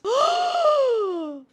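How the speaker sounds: long high-pitched mock gasp; theatrical gasp. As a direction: gasp in exaggerated shock. Mock shock at the reveal.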